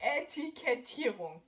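Etikettierung slow, German e and ending ung. Shown as a demonstration. Teacher showing the proper German word, slow. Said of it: "the right way to say it is".

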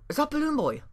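high, loud sudden recognition; startled question. Real, not acted. Spots creepy character in game.

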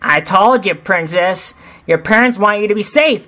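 Timon voice. Acted. Timon scolding Kiara.

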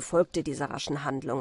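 ordinary delivery, nothing marked

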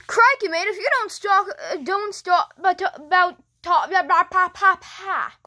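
stumbling mock Aussie that collapses into nonsense syllables; fumbled Aussie tough talk; botched Australian accent. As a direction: lose your grip on an Australian accent, tripping over the words. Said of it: "Being Australian is really hard".

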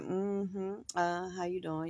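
soft, prim, put-on pleasant greeting; a lightly voiced sweet act; sweet fake politeness. Mama greeting guests with a soft, put-on sweetness. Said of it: "be dressed up like".

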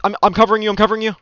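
high, loud urgent callout; urgent shout. Real, not acted. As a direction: yell it over the gunfire. Callout while teammate runs.

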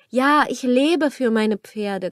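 ordinary talk

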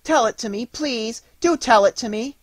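high-pitched, eager pleading; eager cry. A narrator's reading. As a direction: beg like an excited little girl. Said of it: "cried Marie".

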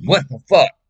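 high, very loud startled cry; sudden outburst. Retold or imitated. Speaker showing how flashbacks hit suddenly, startled outburst. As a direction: explode out of nowhere, high and loud. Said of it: "And then all of a sudden".

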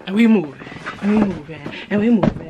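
loud hype chant; hyped. Real, not acted. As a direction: shout it like a hype man. Hyping the haul, chanting.

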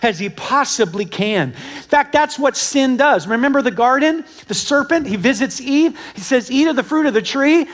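high, loud, driving sermon delivery; full-force preaching. Acted. Preacher warning the congregation about Satan, full-force preaching.

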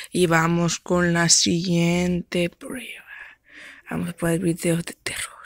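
soft, breathy contest host; whispered announcer. Acted. Host whispers the contest to the audience. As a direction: whisper it like a secret show.

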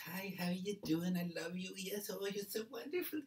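put-on high, gushing little-old-lady voice; sweet old lady. Witness imitates the old lady greeting children, gushingly sweet. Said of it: "she was really sweet".

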